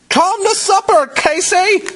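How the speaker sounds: very high, sing-out mother's call. A narrator's reading. Mother calling Casey to supper, high-pitched call. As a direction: call a child in to eat from another room. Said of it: "her mother called".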